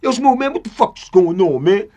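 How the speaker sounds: high, loud comic imitation of a caller. Friend calling [name] to ask what's up, comic loud imitation. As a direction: demand answers down the phone, high and loud. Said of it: "[name] funny, he come on the phone".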